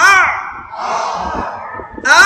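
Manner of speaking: আ pressed hard into the ayn; pressed throat a. Shown as a demonstration. Teacher shows students the ayn, first sound pressed. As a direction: squeeze আ in the throat and hold it. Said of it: "আটাকে চাপ দিবেন".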